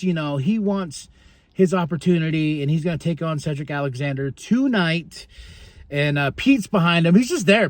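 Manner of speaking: usual speaking voice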